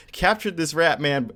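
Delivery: ordinary talk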